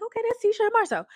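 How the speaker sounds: high, fast, airy brush-off; a quick sing-song let-it-go voice; breezy mock dismissal. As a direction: toss it off lightly and move on. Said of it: "be like".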